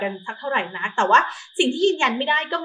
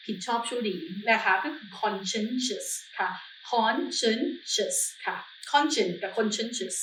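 slow careful contrast; 7 dB quieter, much slower than usual. Shown as a demonstration. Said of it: "teaching word pair".

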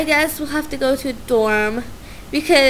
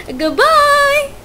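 cheery farewell; 11 semitones higher, 5 dB louder than usual. Real, not acted. Signing off before loading.

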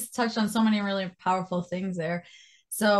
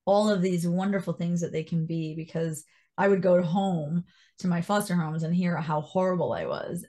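normal talking voice

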